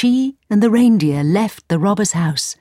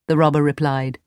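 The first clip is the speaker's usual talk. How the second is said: plain reading voice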